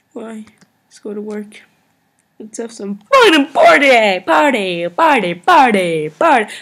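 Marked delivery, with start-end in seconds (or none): 2.4-6.5 loud, rowdy chant of party; party yell. Real, not acted. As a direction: whip up a wild party. Celebrating wildly before the end.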